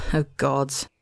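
a tired god groaning at a stubborn stag; weary groan. Acted. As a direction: let the words sag out of him in exasperation. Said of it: "George groaned".

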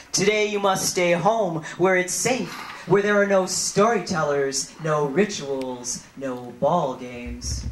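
rhythmic spoken-word delivery with dropping endings; poem recitation. Acted. Poet addressing Columbus before an audience, rhythmic recitation. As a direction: chant the Arawak words like a roll call.

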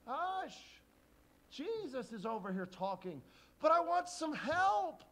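high loud scolding; annoyed hushing. Acted. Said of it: "the disciples say".

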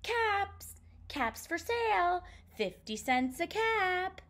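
high, sing-out vendor's cry; peddler's street call. A narrator's reading. Peddler to passers-by with caps to sell, calling out. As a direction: call out your wares in a high voice. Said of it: "he walked back to town calling".

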